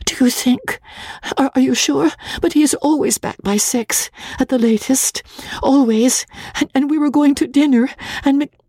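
high, breathless stammering plea; nervous bride asking the clerk for help; timid young wife. A narrator's reading. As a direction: stammer it out, half whispered. Magnolia's voice held.

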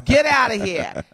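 punchy East Coast dismissal; East Coast accent. Acted. Host shows how they say it out east, East Coast accent. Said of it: "Like they say on the East Coast".

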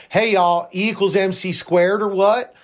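hammed-up Southern twang; Southern accent. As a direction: put on a mock Southern accent. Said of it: "if Einstein had a Southern accent".

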